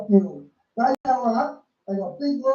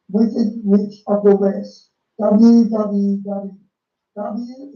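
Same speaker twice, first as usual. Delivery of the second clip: loud, drawn-out WWW; slow, loud emphasis; 7 dB louder, much slower than usual. Shown as a demonstration. Pointing out the letters to viewers, loud and slow. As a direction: hammer each W slowly. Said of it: "spelling out the Ws".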